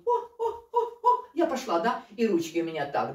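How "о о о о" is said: high squeaky oh-oh cries; wobbly balancing cries. Shown as a demonstration. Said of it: "Это не просто вот".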